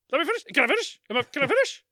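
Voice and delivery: mock outrage. Host jokingly insists on finishing, loud mock outrage. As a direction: demand to finish, hammed up. Mock indignant interruption outburst.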